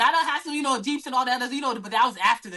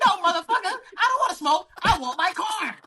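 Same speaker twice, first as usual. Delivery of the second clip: mock angry retort; 8 semitones higher, 3 dB louder than usual. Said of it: "sometimes it's like".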